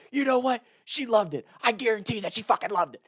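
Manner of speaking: high, insistent comeback; retold defiant retort. Tackler talks back to screaming dock girls, defiant and high. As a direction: insist on it, pitch jumping up.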